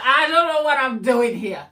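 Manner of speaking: hammed-up helpless cry to a friend; mock despairing outburst. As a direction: blurt it out as a playful meltdown. Acts out her meltdown to friend.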